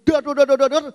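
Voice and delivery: loud mock drum bangs, dor dor; shouted dor dor like a hollow drum; booming drum noise. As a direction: bang out the empty drum sound. Imitating empty drum booming.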